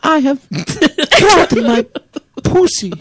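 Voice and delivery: put-on very high, slow, laughing Polish accent; mock Polish accent. Mocking the sex-starved Polish woman, in a high Polish accent. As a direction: ham up a broken Polish accent while laughing. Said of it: "That's Polish, right?".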